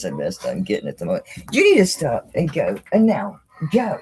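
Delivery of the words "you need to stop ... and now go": loud, high command to the dog; firm command. Real, not acted. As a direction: order the dog to move, sharply.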